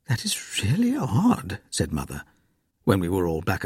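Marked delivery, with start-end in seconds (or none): 0.1-1.6 puzzled near-whisper; hushed. A narrator's reading. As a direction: murmur it in disbelief. Mother puzzled, speaks hushed.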